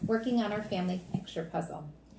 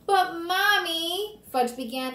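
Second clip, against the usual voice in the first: high, drawn-out, louder whine; pleading child; 9 semitones higher, 6 dB louder, slower than usual. Acted. Fudge begging Mom to relent, whining. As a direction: plead slowly with your mother.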